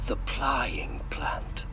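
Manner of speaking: a drawn-out, hushed whisper; slow whisper. Acted. Guide whispering the next building's name to visitors. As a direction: lean in and whisper the name slowly. Whispered exhibit title on tour.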